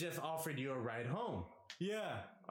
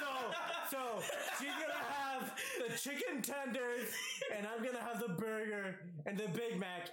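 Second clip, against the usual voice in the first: laughing through words; 10 semitones higher than usual. Real, not acted. Laughing while retelling order.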